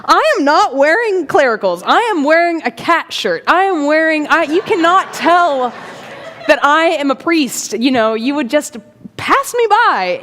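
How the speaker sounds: high, hammed-up exasperated protest; mock indignation. Priest out of clericals protests being spotted, mock indignant. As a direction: protest in comic disbelief, punching the words.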